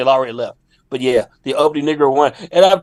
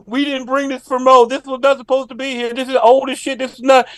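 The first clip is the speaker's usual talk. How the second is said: higher, hurried panicky pleading; panicked manager; 7 semitones higher than usual. Retold or imitated. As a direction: play the flustered staff, pitched up. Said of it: "They're like".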